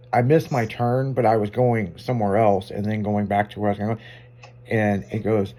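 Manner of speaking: usual speaking voice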